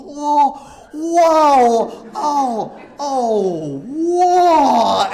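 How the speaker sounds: high, loud dazed exclamations; imitating Toshi's surprise. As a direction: come up stunned from the water. Toshi surfacing from shallow water.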